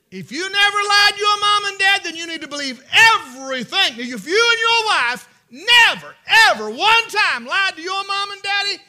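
loud, high shouted preaching; full-force preaching. Real, not acted. Preacher shouts a warning to parents. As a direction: thunder it at the congregation.

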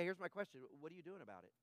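usual speaking voice

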